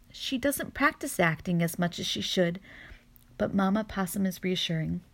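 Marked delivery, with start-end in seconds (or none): none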